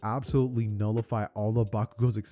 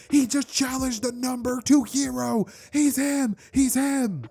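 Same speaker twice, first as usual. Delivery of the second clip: loud, very high excited yelling; hyped crowd shout; 12 semitones higher, 6 dB louder, slower than usual. Acted. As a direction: yell like an amazed fan in the stands. Stunned crowd hypes the challenger.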